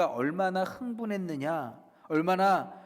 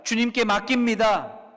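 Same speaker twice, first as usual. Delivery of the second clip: raised, loud declaration to God; bold confession; 6 semitones higher, 8 dB louder than usual. Acted. Believer confessing trust to the Lord, bold declaration. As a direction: proclaim it with full conviction. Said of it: "또 이렇게 고백합니다".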